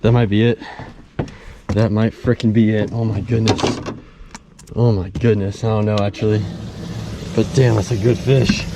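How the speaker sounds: excited. Real, not acted. Angler guessing the bass's weight, excited. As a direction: keep it loud and worked up.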